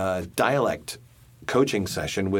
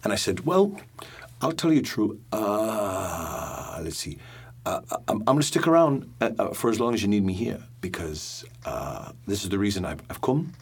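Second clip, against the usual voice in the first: put-on a light Geordie accent held through the story. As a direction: tell the tale in a mild Geordie lilt. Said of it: "can you now just, like, riff in that dialect".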